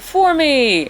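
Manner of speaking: high drawn-out greeting call; mock crowd welcome, pitched high; imitated group cheer. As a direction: call it out like friends greeting an arrival. Whole chat greets her arrival.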